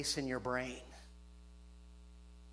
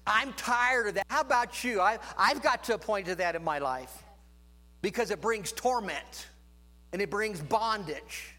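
loud, higher preaching voice building fervour; fiery preaching; 7 semitones higher, 6 dB louder than usual. Acted. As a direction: thunder it from the pulpit to the congregation. Preaching at full force.

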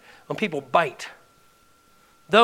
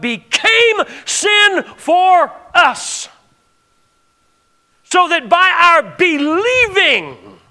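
loud, high, drawn-out proclamation; full-force preaching; 8 semitones higher, 9 dB louder, slower than usual. Real, not acted. Preacher declaring righteousness by faith to congregation, full-force preaching. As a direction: ring out each phrase slowly and forcefully.

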